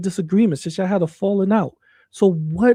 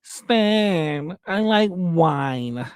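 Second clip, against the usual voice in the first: Roger the Alien; much slower than usual. Retold or imitated. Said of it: "You sound like Roger the Alien".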